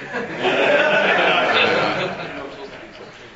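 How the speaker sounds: amused laugh at his own confession; long laugh. Real, not acted. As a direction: let a full laugh roll out.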